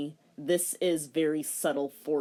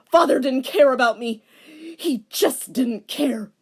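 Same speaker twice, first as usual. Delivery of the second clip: bitter outburst; 7 semitones higher, 9 dB louder than usual. Acted. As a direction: spit out the hurt, slowing at the end. Villain bitter about father.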